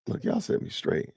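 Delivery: ordinary delivery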